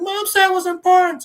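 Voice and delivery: put-on high, loud child's plea; protesting kid. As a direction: imitate a kid yelling in protest. Kid protests while fleeing.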